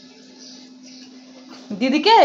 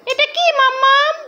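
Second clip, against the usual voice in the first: pretend voice; 10 semitones higher than usual. Mother voices a pretend figure.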